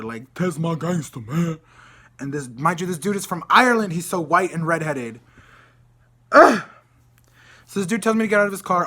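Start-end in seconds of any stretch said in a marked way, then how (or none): 0.3-1.6 mocking put-on gangster drawl; exaggerated fake street voice; gangster act. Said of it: "he was acting very gangster". 6.3-6.6 loud, high disgusted grunt; sharp noise of disgust; disgusted ugh. Real, not acted. Disgust at the driver's act.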